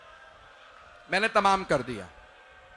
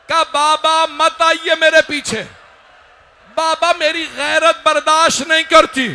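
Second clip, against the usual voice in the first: impassioned majlis preaching; 4 semitones higher, 5 dB louder than usual. Acted. Preacher recounts Hussain pleading with his son, impassioned preaching. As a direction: preach the tragedy at full force.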